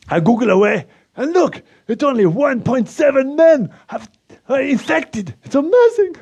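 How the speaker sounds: put-on a proud Eastern European accent, voice pushed up high; Slovak accent. Anton gloats about Slovakia's covid numbers in a high Slovak accent.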